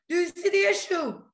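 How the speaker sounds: incredulous emphatic repeat; exasperated repeat. Real, not acted. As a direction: push it like you can't believe they miss it. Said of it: "repeated louder, higher".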